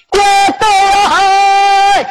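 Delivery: drawn-out high shout; soldiers shouting. Acted. Soldiers yelling at the crowd, drawn-out shout. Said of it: "这些军兵高喊".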